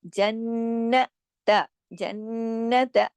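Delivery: जन्नत with the नून hummed long in the nose; very slow nasal recitation of जन्नत; held nasal ghunna. Shown as a demonstration. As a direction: stretch the नन of जन्नत through the nose. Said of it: "पहले जन और फिर ना".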